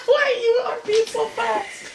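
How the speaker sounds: very high, slow, squeaky laughing voice; laughing question. Real, not acted. Big brother teasing his frightened brother, laughing. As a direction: squeeze the question out through laughter.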